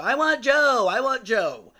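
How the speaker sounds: high, loud drawn-out holler; imitated patient's loud call. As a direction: holler it so the whole floor hears. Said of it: "you could hear her literally".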